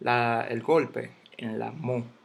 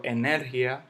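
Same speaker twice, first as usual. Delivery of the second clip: "energía" stressed on NER, e-NER-gia; mis-stressed "energía" as eNÉRgia; wrong stress on NER. Shown as a demonstration. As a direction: punch NER in "energía", run "gia" together. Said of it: "yo no digo".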